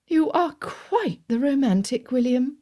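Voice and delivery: aunt amazed at her nephew; shocked. A narrator's reading. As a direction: marvel at him, drawn out. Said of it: "Did she have to sound shocked?".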